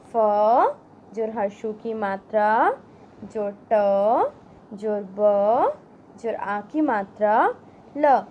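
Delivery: put-on slow, spelled-out syllables for children; syllable drill. Shown as a demonstration. Teacher sounding out gulab for children, slow and clear. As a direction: build the word sound by sound.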